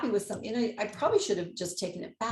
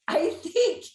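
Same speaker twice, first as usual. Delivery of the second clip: a laugh bursting into the words; 10 semitones higher, 5 dB louder than usual. Real, not acted. Telling the dealer story, laughing. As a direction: laugh right through it.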